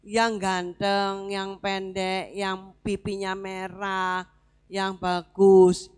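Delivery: drawn-out, savoring each trait; slow fond listing. Acted. Preacher describing young David to congregation, slow and drawn out. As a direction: linger on every word slowly.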